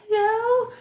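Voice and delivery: put-on very high child's phone answer; little kid. Little brother answers the house phone, in a tiny high voice. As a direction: pipe up like a small boy. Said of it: "he's, like, little".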